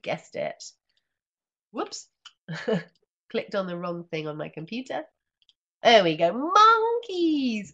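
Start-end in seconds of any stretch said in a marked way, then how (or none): 6.3-7.7 high, loud, delighted; excited reveal. Acted. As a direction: proclaim it joyfully. Reveal of page.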